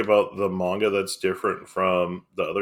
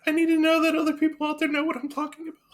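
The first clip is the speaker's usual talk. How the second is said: squeaky, very high laughing voice; laughing plea. Real, not acted. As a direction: plead through helpless laughter, pitched way up. Laughing plea after obscure reference.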